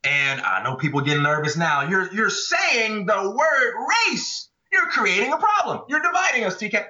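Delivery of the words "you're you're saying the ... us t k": mock outraged critic. Mocking nervous critics of race talk.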